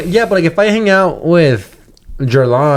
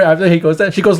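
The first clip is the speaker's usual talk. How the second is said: ordinary talk, nothing marked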